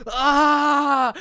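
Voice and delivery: high loud mock scream; imitated scream. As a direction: let out a wild hammed-up yell. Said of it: "He's screaming".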